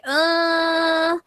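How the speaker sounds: high, long hesitant "uh"; reluctant uh. Retold or imitated. Voicing her reluctance to the fans.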